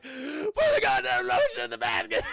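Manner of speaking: high, loud hammed-up scream; exaggerated raging command; mock furious order. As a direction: yell it like an exasperated movie villain. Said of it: "He's like".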